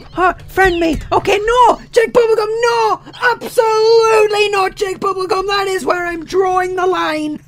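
high, loud mock-furious yell; mock rage shout. Real, not acted. Gamer raging at a friend request, high shouting. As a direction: refuse him with a laughing scream.

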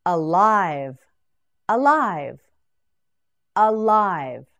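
alive spoken loud and clear, each syllable distinct; clear model word. Shown as a demonstration. Teacher models alive for students, loud and clear. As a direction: say alive crisply for the class to copy. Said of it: "Now you say it".

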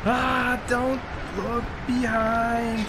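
high, very slow, stretched words; panicky drawn-out warning. Real, not acted. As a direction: drag out the warning as panic rises. Fleeing monsters, panicked repeat.

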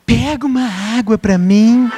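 lightly voiced wife asking for water; a hint of the wife's voice, half laughing; voicing his wife. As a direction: imitate the wife's casual request with a laugh. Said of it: "A minha mulher vira e fala assim".

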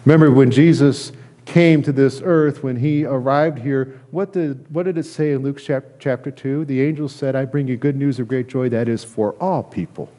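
usual speaking voice

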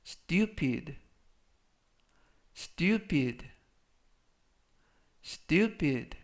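stupid said clearly three times; pronunciation demo. Shown as a demonstration. Teacher modelling stupid for learners, careful. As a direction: model stupid slowly for students. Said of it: "라고 발음하시면 되겠고요".

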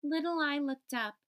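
plain reading voice

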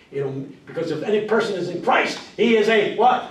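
loud, high questions to the crowd; call and response preaching. Shown as a demonstration. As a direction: lead the crowd to finish each line.

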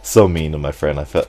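usual speaking voice

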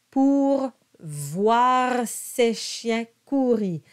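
slow, careful French: monta with a nasal O; slow French model. Shown as a demonstration. Coach reads the verse slowly for students. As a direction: sound out each French syllable. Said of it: "the word voir is a combined vowel sound".

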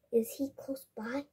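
soft unvoiced whisper. A narrator's reading. Rahib asks grandfather about the frog, whispering. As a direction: whisper it so the frog won't hear. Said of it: "whispered Rahib".

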